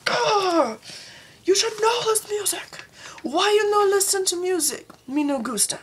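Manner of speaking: high, hammed-up indignant squawk; exaggerated exasperated wail; mock outrage. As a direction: scold in a silly high voice. Acted outrage at music fake.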